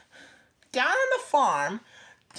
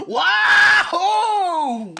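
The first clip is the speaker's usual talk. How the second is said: much higher, loud whoop; wahoo; 8 semitones higher, 10 dB louder than usual. A narrator's reading. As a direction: whoop with joy. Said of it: "what he thought".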